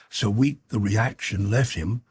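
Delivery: usual speaking voice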